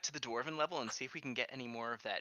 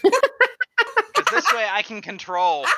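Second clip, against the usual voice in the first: laughing; 8 semitones higher, 13 dB louder, much slower than usual. Real, not acted. Laughing rebuttal of the joke.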